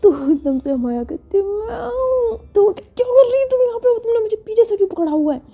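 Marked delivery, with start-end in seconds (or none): 1.4-2.4 very high, slow drawn-out reply; childish whine. Acted. Maya hugging carer from behind, whining. 2.8-5.4 very high, rapid outburst; alarmed scolding. Acted. Carer to Maya clinging behind, alarmed scolding.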